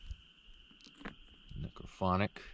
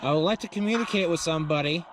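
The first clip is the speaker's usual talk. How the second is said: raised, projecting voice to spirits; calling out; 4 semitones higher, faster than usual. Real, not acted. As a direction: speak up to unseen listeners. Addressing spirits aloud, ghost hunt.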